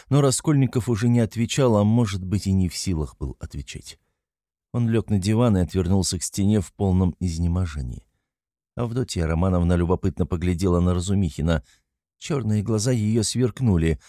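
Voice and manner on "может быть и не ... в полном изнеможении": ordinary delivery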